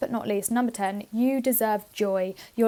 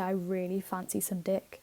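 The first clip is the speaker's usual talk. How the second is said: softer, lower cheeky version of the line; mischievous murmur; sly quiet swap; 3 semitones lower, 7 dB quieter than usual. Shown as a demonstration. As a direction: drop it low as a naughty joke. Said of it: "I could say".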